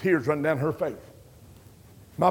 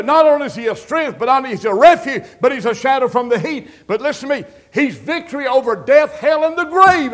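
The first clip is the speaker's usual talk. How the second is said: loud, rising gospel preaching, higher and louder; preaching at full force; 4 semitones higher, 7 dB louder than usual. Acted. Preacher stirring up the congregation with full force preaching. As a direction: build it up like a revival preacher.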